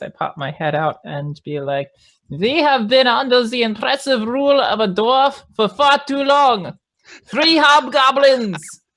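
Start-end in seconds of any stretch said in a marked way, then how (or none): 2.3-8.8 high, rousing goblin orator; rally the troops in a put-on goblin voice; hobgoblin disguise voice. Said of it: "with my disguise voice".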